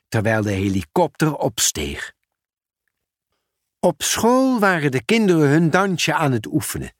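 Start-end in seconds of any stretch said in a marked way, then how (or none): none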